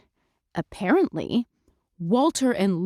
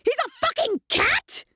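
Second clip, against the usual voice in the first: high, shrill-pitched outburst; exasperated. Retold or imitated. Mandy to Lulu, exasperated high outburst. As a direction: squeak it out in disbelief.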